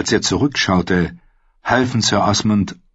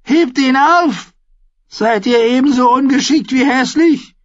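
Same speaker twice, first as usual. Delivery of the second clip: put-on much higher and louder, screeching young king; shouting boy king; 5 dB louder than usual. A narrator's reading. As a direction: yell it down at the dwarf. Joffrey orders Tyrion again.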